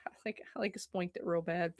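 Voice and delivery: hushed, wincing after hitting her hand; nursing a sore hand, half under breath; pained mutter. Real, not acted. After banging her hand, wincing quietly.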